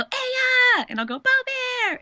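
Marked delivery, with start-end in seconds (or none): 0.0-0.8 very high, loud mimicked kid yell; high-pitched imitation holler; louder toddler holler. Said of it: "he gets louder and louder". 1.2-2.0 very high playful call back; high drawn-out pet-name holler; louder answering holler. Retold or imitated. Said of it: "he gets louder and louder".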